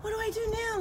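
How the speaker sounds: high, puzzled little squirrel voice; voicing the squirrel. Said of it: "He's like".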